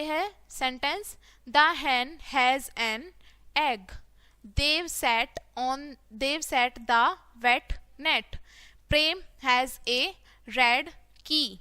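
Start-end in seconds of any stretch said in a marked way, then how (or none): 1.5-11.6 very slow, separated words; word-by-word reading. Shown as a demonstration. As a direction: read it out slowly for beginners. Teacher models reading word by word. (धीरे-धीरे, एक-एक शब्द पढ़ना)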